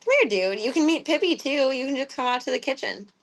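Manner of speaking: ordinary delivery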